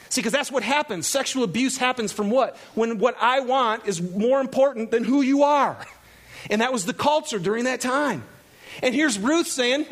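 normal talking voice

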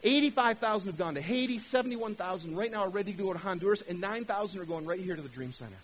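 ordinary talk, nothing marked

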